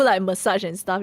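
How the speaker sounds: normal talking voice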